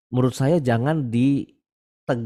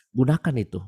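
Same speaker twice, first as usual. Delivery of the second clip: usual speaking voice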